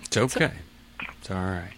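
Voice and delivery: soft, hushed comfort; gently reassure, dropping almost to a whisper; soothing reassurance. Real, not acted. Host comforting a caller who is near tears, soothing.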